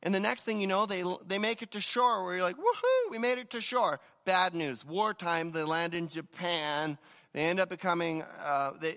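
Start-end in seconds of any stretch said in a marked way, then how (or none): 2.6-4.0 high "Woohoo" whoop, mock celebration; playful whoop of relief; mock cheer. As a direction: yelp it out like a lucky survivor. Mock cheer on reaching shore.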